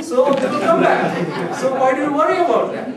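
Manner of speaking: high, loud chuckle through the words; giggling dismissal; laughing. Retold or imitated. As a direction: laugh it off, carefree. Laughing at his own joke.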